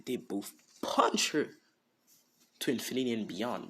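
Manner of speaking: usual speaking voice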